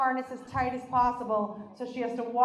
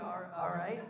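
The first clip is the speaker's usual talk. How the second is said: awkward guide; 7 semitones lower, 10 dB quieter than usual. Retold or imitated. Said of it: "he was like".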